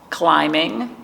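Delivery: usual speaking voice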